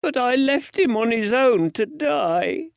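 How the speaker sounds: put-on very high, upset child's voice; guilty little lad near tears; plaintive, high-pitched boy; tearful boy. A narrator's reading. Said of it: "The child's bottom lip quivered".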